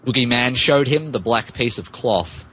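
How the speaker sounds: normal talking voice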